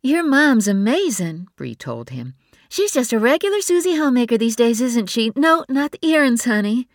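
a breezy younger sister full of praise; fast, high gushing sister voice; Bree's voice. A narrator's reading. Bree voiced by narrator.

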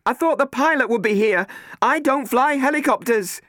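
high, loud and insistent; insisting. Acted. Catherine insisting to Matt she can't fly, loud. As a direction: insist you're not to blame. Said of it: "Catherine insisted".

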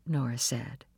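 plain reading voice

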